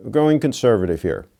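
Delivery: ordinary talk, nothing marked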